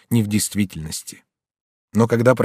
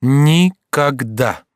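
very slow, higher-pitched, each syllable apart; adamant refusal; 4 semitones higher, 4 dB louder, much slower than usual. A narrator's reading. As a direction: refuse syllable by syllable, drawn right out. Mother repeats refusal, slower. (непреклонно, по слогам)

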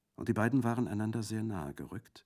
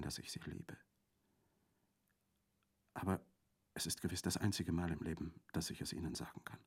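soft, breathless whisper of love; a lover's hushed admission; whispered confession; 7 dB quieter than usual. Acted. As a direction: confess it under your breath, faster at the end.

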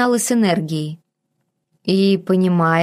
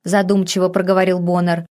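ordinary delivery

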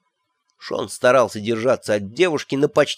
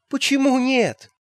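high, slow puzzled why not; innocent surprise; 13 semitones higher than usual. A narrator's reading. Magrat asking the terrified elf why not, mock innocent. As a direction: sound sweetly baffled.

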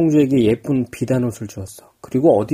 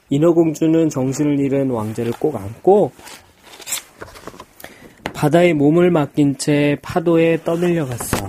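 ordinary delivery, nothing marked

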